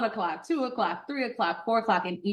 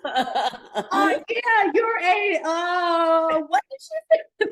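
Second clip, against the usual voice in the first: very high mock baffled question; puzzled men; 12 semitones higher, 4 dB louder, much slower than usual. Men at the bottom asking, high and baffled. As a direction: play the confused men asking. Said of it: "the men".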